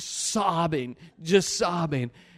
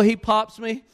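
ordinary talk

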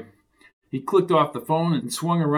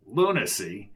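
ordinary delivery, nothing marked

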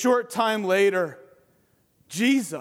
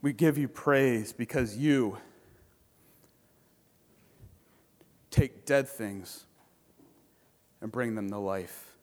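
closing prayer; 7 semitones lower, 3 dB quieter than usual. Acted. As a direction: pray it softly with long pauses. Closing prayer after sermon.

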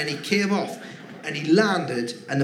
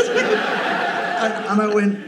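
much higher and slow, collapsing into laughter; laughing; 6 semitones higher, 4 dB louder, slower than usual. Real, not acted. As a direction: try to repeat it but crack up. Repeating quote, breaks into laughter.

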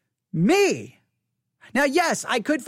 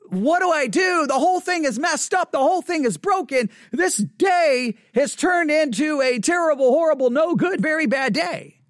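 higher-pitched frantic outburst; acted-out meltdown, pushing each phrase high; retold exasperation; 5 semitones higher than usual. Pastor replaying his inner panic over the broken sermon, exasperated.

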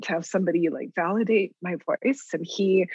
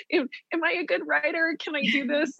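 higher, eager, anxious question; anxious hope; 9 semitones higher than usual. Retold or imitated. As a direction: beg for approval with nervous hope.

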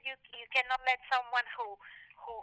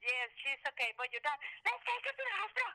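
a high, hurried imitation of a worried parent; 8 semitones higher, faster than usual. Mother urging dad to the hospital, imitated worry. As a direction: rattle it off as if fearing the worst. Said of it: "Julie stayed there crying".

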